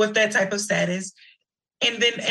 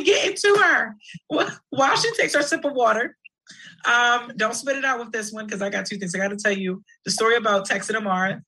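normal talking voice

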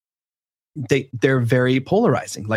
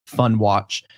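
ordinary talk

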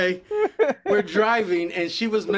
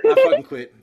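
high, loud retold outburst; exasperated; 8 dB louder than usual. Replaying his end of the deer argument, exasperated. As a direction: burst out as if giving up.

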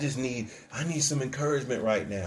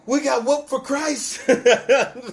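high, loud, drawn-out exultant shout; triumphant rallying cry; 10 semitones higher, 7 dB louder, much slower than usual. Acted. Early believers regrouping after a beating, triumphant shout. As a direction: whoop it out like a battered but thrilled believer.

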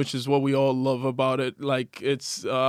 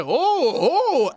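surprised gasp; 11 semitones higher than usual. Retold or imitated. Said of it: "I was like".

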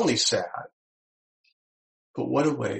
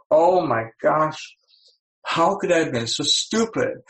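mock self-reproach; 4 semitones higher, 4 dB louder than usual. A person scolding themselves over a bonehead mistake, in mock dismay. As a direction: berate yourself in mock horror.

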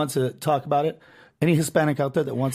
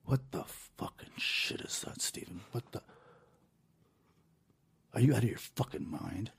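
angry whisper; 5 semitones lower, 11 dB quieter than usual. Retold or imitated. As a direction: scold in a furious hush so nobody hears. Dad scolds him out of earshot.

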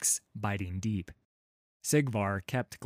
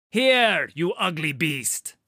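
loud, high taunting call; 8 semitones higher, 9 dB louder than usual. A narrator's reading. Halla taunting the creature away from Sigvar, loud call. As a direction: yell to draw the beast away. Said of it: "Halla called out".

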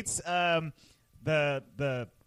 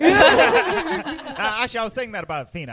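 laughing; 6 semitones higher, 10 dB louder than usual. Real, not acted. Laughing correction.

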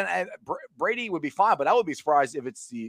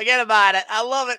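higher, louder imitation of a New York accent; 7 semitones higher, 6 dB louder than usual. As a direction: echo the line back as a hammed-up New Yorker. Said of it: "Oh, that's New York".

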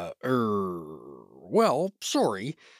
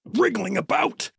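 straining; much slower than usual. Acted. Lifting a companion out of pit.

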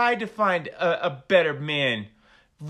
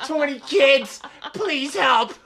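loud, higher begging through laughter; laughing mock plea; 7 semitones higher, 9 dB louder, slower than usual. Mocking Cody's imagined sales sign to cohost, loud laughing plea. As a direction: ham up a pathetic beggar's sign, cracking up. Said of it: "I could picture the signs he was trying to sell".

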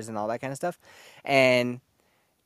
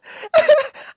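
shrieking laugh; cracking up mid-story; high squealing laugh; 10 dB louder than usual. Real, not acted. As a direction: burst out laughing at yourself. Laughing at own awkwardness.

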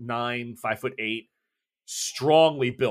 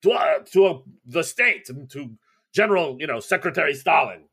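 raised loud Russian toast; 5 semitones higher, 6 dB louder than usual. Acted. Breslovich toasting Stalin to the guests, loud. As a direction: propose a toast to the state. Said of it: "And he's like".

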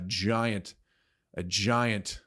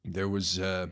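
usual speaking voice

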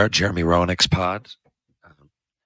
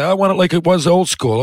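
loud, higher mock grumble; mocking old-timer; 9 semitones higher, 5 dB louder than usual. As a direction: imitate a cranky traditionalist.

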